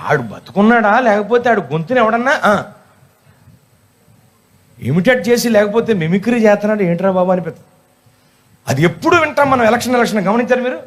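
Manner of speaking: ordinary delivery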